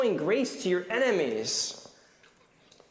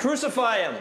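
raised, mock-angry crowd call; angry jeer; 7 semitones higher than usual. Shown as a demonstration. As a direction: lead the children in a pretend jeer. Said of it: "we're gonna do an angry face".

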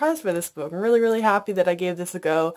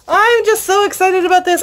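excited; 10 semitones higher, 6 dB louder than usual. Real, not acted.

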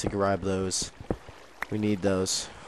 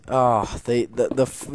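ordinary talk, nothing marked

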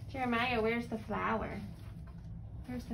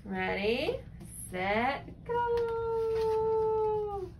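game countdown; 10 semitones higher, 4 dB louder, much slower than usual. Pretend. Parent starts a game with child, building excited countdown. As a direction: build suspense, then sing out the last word.

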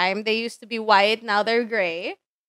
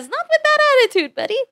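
much higher, fast squeaky "Not with that attitude, buddy"; squeaky mock retort; 14 semitones higher, faster than usual. Streamer sassing herself back in a squeaky fast voice.

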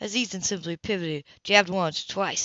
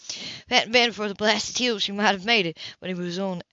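ordinary delivery

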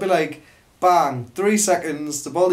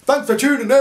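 high, bright burst of intro; mock hype intro; sudden cheery call; 8 semitones higher, faster than usual. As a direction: pop it out of nowhere. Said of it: "all of a sudden it goes".

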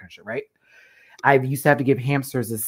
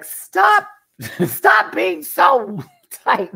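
high yelling with laughter, dragged out slow; mock yelling; 8 semitones higher, 8 dB louder, much slower than usual. Yelling at a dancer, high and laughing. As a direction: shout it high through giggles as if yelling into a booty.

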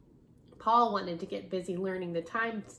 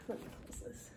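whispered aside; 14 dB quieter, faster than usual. Real, not acted. Aside while handling book.

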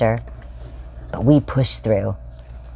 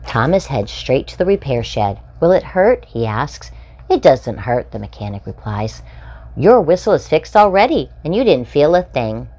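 bright, lively storybook read-aloud; animated children's story reading; slower than usual. A narrator's reading. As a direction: read it like a picture book for little ones. Reading picture book to children.

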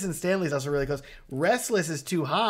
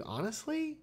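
can't believe it's only his fifth; amazed disbelief; 8 semitones higher, 6 dB quieter than usual. Real, not acted. Host amazed at a beginner's fifth wedding, incredulous. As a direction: pitch jumps up on fifth wedding.

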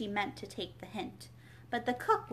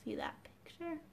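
unvoiced whispered aside; a soft whispered question; 7 semitones higher, 11 dB quieter than usual. Real, not acted. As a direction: lean in and whisper.

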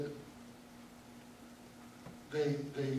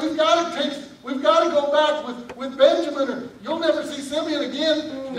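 urgent sons; 7 semitones higher, 7 dB louder than usual. Acted. Sons urge Dad.

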